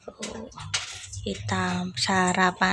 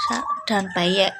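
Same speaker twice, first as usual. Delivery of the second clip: ordinary talk, nothing marked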